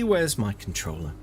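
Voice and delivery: ordinary talk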